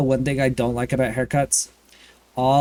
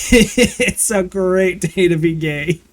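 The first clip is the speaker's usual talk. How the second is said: higher, slow and savoured through a laugh; laughing, drawn out; 4 semitones higher, 3 dB louder, slower than usual. Acted. Streamer to chat, slow laughing singsong. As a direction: stretch it out, giggling at the joke.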